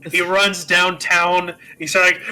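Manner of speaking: ordinary talk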